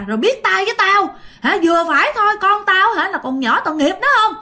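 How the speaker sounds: acted tirade, high and loud; angry scolding. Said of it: "bà ngoại năm mới chửi".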